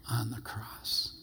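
soft breathy whisper, slowed down. Real, not acted. Preacher whispering to the congregation about the cross. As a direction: let the words fall to a whisper.

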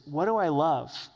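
first question put out loud, falling; pointed question. Real, not acted. Pastor asking the church the first question, pointed. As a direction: pose it firmly to the room. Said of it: "Three questions for us tonight".

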